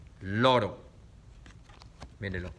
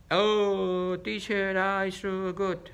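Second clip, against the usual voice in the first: slow, lightly mocking child voice; imitating a pupil; 5 semitones higher, much slower than usual. Teacher mocking her pupils' broken English, slow and light. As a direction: drag it out like a kid in broken English. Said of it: "ya no me van a decir".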